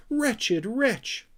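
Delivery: high indignant cry; angry squeal. Acted. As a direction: spit out the insult in outrage. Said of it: "screamed Sniff".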